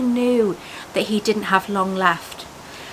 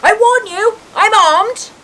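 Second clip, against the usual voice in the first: loud, high-pitched threat; shouted warning; 12 semitones higher, 8 dB louder, slower than usual. A narrator's reading. Uncle Vernon armed against an intruder, shouting. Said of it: "he shouted".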